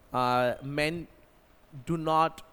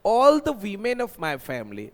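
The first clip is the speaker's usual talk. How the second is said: slow, louder teaching phrase; slow emphatic model; 4 semitones higher, 7 dB louder, much slower than usual. Shown as a demonstration. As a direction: drive the phrase home slowly. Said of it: "मैं किसी एक महिला की नहीं बात कर रहा हूँ". (धीमे और ज़ोर से)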